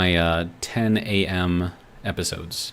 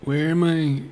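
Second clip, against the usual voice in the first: high, put-on voice of a confused president; presidential impersonation; 7 semitones higher, 5 dB louder than usual. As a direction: ham up a president losing the thread, with long halting pauses. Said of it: "in our best presidential, uh, impersonation".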